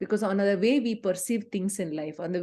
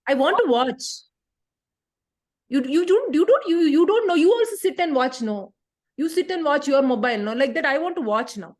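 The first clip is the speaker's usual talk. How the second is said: put-on loud, high-pitched defiant teen; insistent, raised-voice protest; back-talking child; 5 semitones higher, 5 dB louder than usual. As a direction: argue back indignantly at your mother. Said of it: "What kind of a tone is that".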